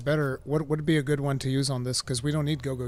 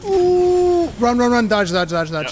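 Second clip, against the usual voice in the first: loud, high, urgent calls; urgent shouting; 9 semitones higher, 7 dB louder, slower than usual. Real, not acted. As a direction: yell warnings at a teammate. Urgent warning during boss attack.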